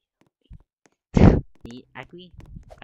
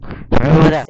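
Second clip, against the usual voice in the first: shocked shout; 23 dB louder than usual. Real, not acted. Shocked by the ride.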